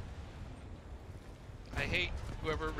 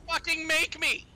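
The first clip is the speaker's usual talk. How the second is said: high, loud defiant yell; 13 semitones higher, 4 dB louder, slower than usual. Real, not acted. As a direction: yell a dare back. Shouting match over swearing.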